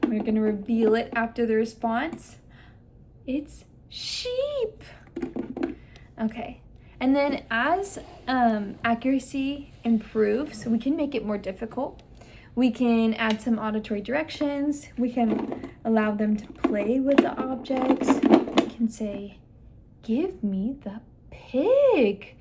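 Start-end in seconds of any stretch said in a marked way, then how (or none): 3.3-4.8 very high, sing-out "sheep"; highlighting on SHEEP. Shown as a demonstration. Clinician reveals the toy after the child's response, highlighting SHEEP. 20.0-22.4 very slow, with "pig" stretched and stressed; emphasis on PIG. Shown as a demonstration. Clinician gives the child a direction, emphasizing PIG. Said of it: "putting that emphasis on our target words".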